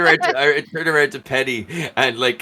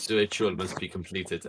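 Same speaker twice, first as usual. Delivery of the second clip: low, softer ritual pronouncement; subdued, low character voice; in-character solemn drone; 7 semitones lower, 10 dB quieter than usual. Acted. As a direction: echo the order in a low trance. Repeats the ritual line in character.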